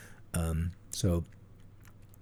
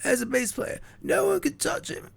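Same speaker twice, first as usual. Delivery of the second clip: much higher, put-on [name] voice; [name] impression. Doing [name] for his co-host, high and put-on. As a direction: squeak it out like [name]. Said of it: "just do your [name] impression".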